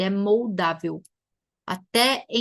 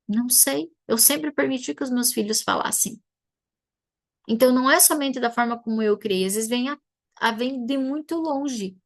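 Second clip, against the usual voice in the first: ordinary talk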